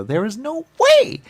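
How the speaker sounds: high, loud and slow "no way"; shocked denial. Retold or imitated. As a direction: blurt it out in disbelief, drawn out. Shocked reaction to accusation.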